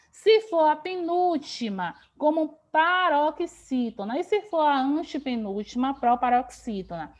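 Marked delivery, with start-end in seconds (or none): 2.7-4.2 "paroxítona" drawn out syllable by syllable; slow, emphatic term. Shown as a demonstration. Teacher naming the penultimate case, slow and emphatic. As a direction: stretch each syllable of "paroxítona" slowly. Teacher spells out the category name.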